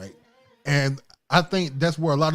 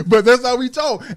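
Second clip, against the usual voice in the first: high, loud laughing insistence; excited laughing retort; 9 semitones higher, 8 dB louder than usual. Real, not acted.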